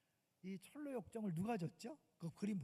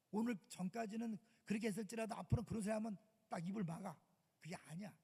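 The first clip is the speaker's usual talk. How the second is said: ordinary talk, nothing marked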